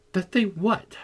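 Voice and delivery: high, startled outcry; troubled cry. A narrator's reading. Catherine cuts in on Heathcliff's complaint, crying out high and troubled. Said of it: "cried Catherine, gazing at him with a troubled countenance".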